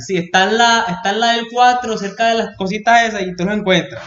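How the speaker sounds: put-on high-pitched Cuban Spanish accent. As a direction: rattle it off in a thick Cuban accent. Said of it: "in the most Cuban accent".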